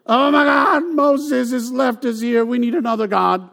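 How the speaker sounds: high, loud hammed-up wail; mock outrage. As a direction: whine that Moses left you. Israelites when Moses left.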